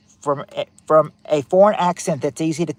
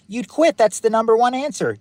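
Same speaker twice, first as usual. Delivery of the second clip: laughing through the answer, pitched up; 7 semitones higher than usual. Real, not acted. Host laughing as he reveals the top answer. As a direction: chuckle it out, amused by the guess.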